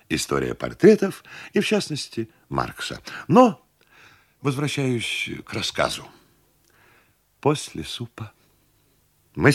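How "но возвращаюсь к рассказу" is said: ordinary delivery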